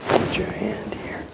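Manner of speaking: whispered "Put your hand here." barely audible. Shown as a demonstration.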